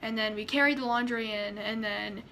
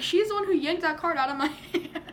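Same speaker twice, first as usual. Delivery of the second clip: higher retold protest cracking up; protest into laughter; 4 semitones higher than usual. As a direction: start indignant and break into a laugh.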